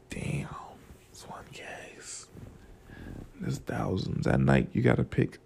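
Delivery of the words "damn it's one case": slow hushed whisper of disbelief; soft, slowed awed murmur; stunned whisper. Retold or imitated. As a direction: let it out under your breath, amazed. Stunned at price of case.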